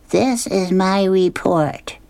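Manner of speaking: put-on a child reading her homework aloud; little girl voice. Sally reads her report.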